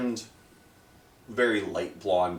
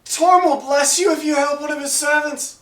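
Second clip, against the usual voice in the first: high, loud, pious plea; a captive invoking his god for rescue; noble's plea; 7 dB louder than usual. Acted. The bound noble promises the party Torm's blessing, high and pleading.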